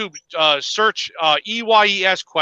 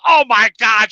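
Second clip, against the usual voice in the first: louder, higher mock outburst; acted-out annoyance, raised voice; retold exasperation; 5 semitones higher, 5 dB louder than usual. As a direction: imitate a wife getting mad. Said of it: "She got all mad".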